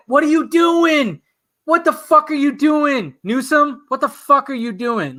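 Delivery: high, slow, angry yelling at the governor; reliving the fury, dragging each word out; pissed off outburst. Retold or imitated. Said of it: "I got so pissed off".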